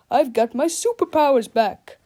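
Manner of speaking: loud, proud heroic voice; triumphant hero. Acted. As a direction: crow with pride. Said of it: "cried the good captain".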